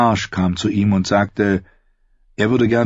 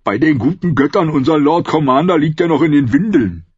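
a touch higher and louder, lightly voiced old brother; old man teasing; 7 semitones higher, 4 dB louder than usual. A narrator's reading. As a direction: tease him like a toothless old man. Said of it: "knackte mit seinen Holzzähnen".

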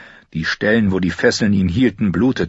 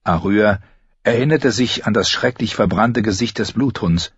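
ordinary delivery, nothing marked

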